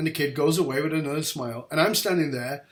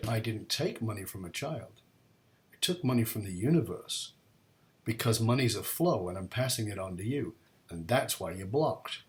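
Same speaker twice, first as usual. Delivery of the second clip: lower, softer, patient teacher; calm wise explanation; 4 semitones lower, 3 dB quieter than usual. Retold or imitated. Bishop explains the coin to his student, calm and lower. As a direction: explain it gently, unhurried. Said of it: "He said".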